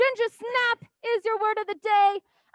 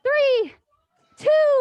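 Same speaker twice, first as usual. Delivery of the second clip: shouted count, higher and louder; 5 semitones higher, 7 dB louder than usual. Real, not acted. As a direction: call out the number. Counting down the workout.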